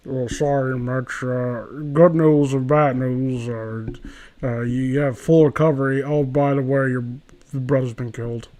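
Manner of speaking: a deadpan low doctor. Retold or imitated. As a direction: deliver bad news low and offhand. Voicing the doctor from story.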